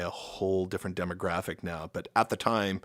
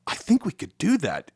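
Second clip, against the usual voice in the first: mock excited; 9 semitones higher, 5 dB louder than usual. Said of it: "we were like".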